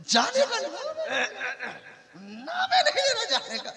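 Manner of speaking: high laughing complaint of a fed-up in-law; exasperated father-in-law. Retold or imitated. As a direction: whine it high through laughter. (झुंझलाया हुआ ससुर, ऊँची हँसती आवाज़)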